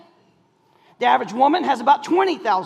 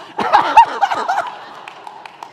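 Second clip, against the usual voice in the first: high laugh; 3 dB louder than usual. Real, not acted. Laughter at punchline.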